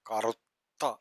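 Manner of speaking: normal talking voice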